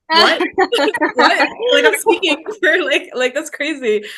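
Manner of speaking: high, loud, incredulous laughter; laughing disbelief. Real, not acted. Once-silent student amazed to be podcast co-host, laughing disbelief. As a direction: burst out laughing at your own unlikely success.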